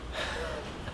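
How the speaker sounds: short, airy laugh at himself; breathless laugh. Real, not acted. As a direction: laugh it off, still winded. Laughs after a terrible shot.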